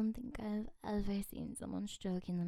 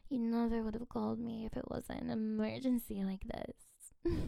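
usual speaking voice

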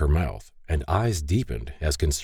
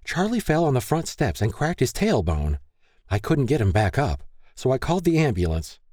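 higher frantic mother voice; 7 semitones higher than usual. Acted. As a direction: relay it in a panic. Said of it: "Ruth frantically relayed".

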